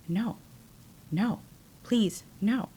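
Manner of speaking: very soft, halting refusals; quiet pleading. Retold or imitated. Recalling what she kept saying to him, quiet pleading. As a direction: plead softly, with pauses between words. Retelling her repeated pleas.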